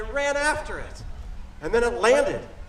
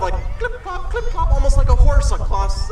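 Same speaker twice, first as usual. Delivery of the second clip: loud clip-clop like a horse; rising into a loud clopping; clip-clop hooves; 7 dB louder than usual. Shown as a demonstration. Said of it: "my foot's made the sound of".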